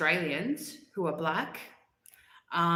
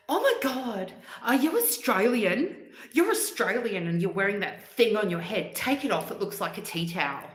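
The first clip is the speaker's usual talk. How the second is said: higher-pitched, appalled Karen act; imitated Karen; 3 semitones higher, 3 dB louder than usual. Woman shocked the hijab wearer is Australian, imitated Karen. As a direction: start high and incredulous, imitating a pushy stranger. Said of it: "like, one of these women".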